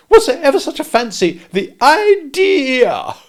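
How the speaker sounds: high, loud indignant lady; indignant Camilla. A narrator's reading. Camilla scorns the boy leaving, high and indignant. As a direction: drag out the idea in outrage. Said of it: "with indignation".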